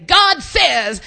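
loud, high, bold Texan drawl on God says; Texan preacher accent. Retold or imitated. Preacher mimicking a Texan prophesying, bold Texan accent. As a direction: boom it out like a Texas preacher. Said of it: "Texans say".

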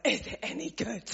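mock astonishment at the question; incredulous echo. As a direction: echo it back as if it can't be real.

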